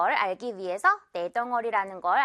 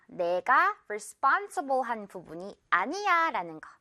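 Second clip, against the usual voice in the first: ordinary talk, nothing marked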